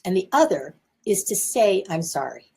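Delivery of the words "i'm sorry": soft, lower, truly meant "I'm sorry"; a sincere, softened apology. Shown as a demonstration. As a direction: say "I'm sorry" quietly from the heart. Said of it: "to truly say it".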